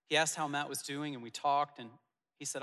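ordinary talk, nothing marked

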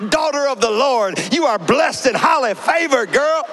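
high, loud gushing praise; shower her with blessings; overjoyed blessing. Retold or imitated. Pastor thanking the officer, gushing high and loud. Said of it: "I said".

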